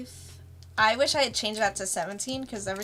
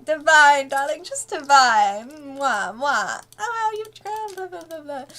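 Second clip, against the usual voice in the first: Excel's diva voice; 5 semitones higher, 7 dB louder than usual. Retold or imitated.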